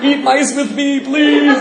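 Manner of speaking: very high, drawn-out mock plea; mock begging. Speaker begs audience to eat mice, hammed-up pleading. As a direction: beg in a squeaky, stretched-out whine.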